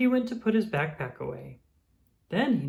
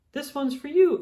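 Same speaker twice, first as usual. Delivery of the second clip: high and louder, eager; excited offer; 12 semitones higher, 5 dB louder than usual. Acted. Daniel giving Oh the Owl his heart, eager and high. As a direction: hand over the present with glee.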